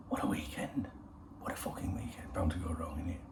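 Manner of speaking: unvoiced breathy aside; whisper. Real, not acted. Musing to viewers on the weekend ahead, whisper. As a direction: whisper it to yourself with relish.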